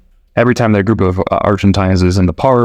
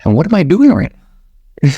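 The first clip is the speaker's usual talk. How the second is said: high-pitched joke punchline; goat farmer; 8 semitones higher than usual. Acted. Farmer turning it back on the tourist, mock incredulous. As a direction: pitch it up as an acted, incredulous question. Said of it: "the goat farmer looks around".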